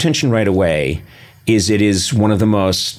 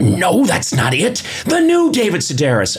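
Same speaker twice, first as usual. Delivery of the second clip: insistent customer voice; 10 semitones higher than usual. Retold or imitated. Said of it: "And he went".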